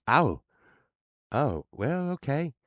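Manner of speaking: high, hesitant neighbor; old neighbor's voice. Retold or imitated. Neighbor hearing where the band is going, high hesitant voice. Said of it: "he was like".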